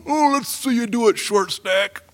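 high, taunting giant voice; mocking Goliath. Goliath taunting little David in a high, sneering voice. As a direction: sneer it down at a tiny opponent, pitched high. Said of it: "this guy's going".

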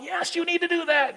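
very high, insistent devil voice; urging devil, acted. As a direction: egg them on in a high mock voice. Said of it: "the devil's going".

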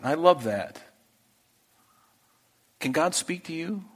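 ordinary talk

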